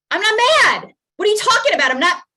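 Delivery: angry shouted denial. Acted. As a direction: shout "I'm not mad" like a cornered parent. Parent denies anger in scene.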